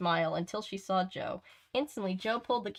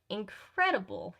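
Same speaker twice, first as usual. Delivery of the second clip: ordinary delivery, nothing marked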